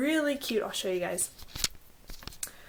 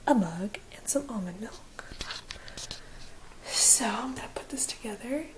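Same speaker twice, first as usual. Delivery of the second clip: whispering. Real, not acted. Whispers while sleeping husband nearby.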